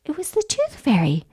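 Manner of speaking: ordinary delivery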